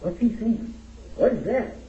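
high-pitched mock bewilderment; imitated puzzled listeners. Folks from Memphis puzzled by the speaker, mock bewilderment. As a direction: mimic baffled onlookers in a high voice. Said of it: "and they were saying".

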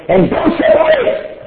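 shouted high, forceful command. Acted. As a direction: thunder the command from the pulpit. Preacher quoting Allah's command.

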